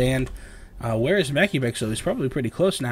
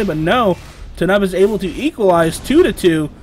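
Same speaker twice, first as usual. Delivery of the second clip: rising hype, louder and higher; excited play-by-play; 4 semitones higher, 6 dB louder than usual. Real, not acted. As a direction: call the cap with building excitement. Caster calls a fast cap.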